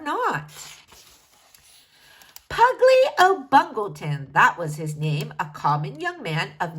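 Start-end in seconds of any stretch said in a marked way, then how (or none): none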